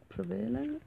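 "prevalent" as pre-VAY-lent, stress moved to second syllable; pronunciation shown. Shown as a demonstration. Guest unsure how we pronounce it, "prevalent" said tentatively as pre-VAY-lent. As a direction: say "prevalent" with a long VAY in the middle, rising, unsure. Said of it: "I think we pronounce it".

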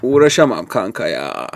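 low, lazy imitation of a friend; imitating friend's voice. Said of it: "aynı sesiyle yapıyorum".

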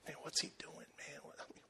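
hushed unvoiced whisper; disciples whispering. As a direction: gossip behind Jesus' back in a whisper. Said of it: "I can see his disciples in the corner ... going".

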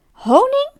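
high, rising cry of disbelief; astonished. A narrator's reading. Bear hears the fox is sick from honey, astonished. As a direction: shoot up into an amazed question.

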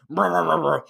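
high mock whimpering brr noises; complaining grumble. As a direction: whine like a hurt baby. Complaining after stubbing toe.

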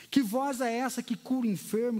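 ordinary delivery

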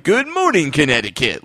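mock morning-show greeting; morning show host. Parodying a knockoff morning show's opening, mock host voice. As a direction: sing out a cheesy show opener. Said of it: "it's a knockoff".

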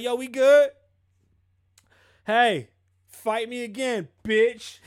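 hammed-up street taunt; mock tough yell. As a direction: yell a cocky dare. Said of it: "You're like".

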